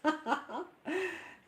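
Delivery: real laugh at the viewer; bright laugh. Real, not acted.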